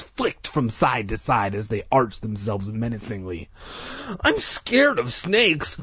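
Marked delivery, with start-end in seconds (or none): 4.2-5.8 high, slow, frightened admission; timid fear. A narrator's reading. Mike confessing his fear of snakes, timid and high. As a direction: draw it out in a small high voice. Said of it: "Mike uttered in a soft voice".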